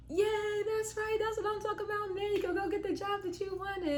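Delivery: very high-pitched cheering, imitated; excited sister. Sister celebrating the passed exam, excited high voice. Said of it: "She was so excited".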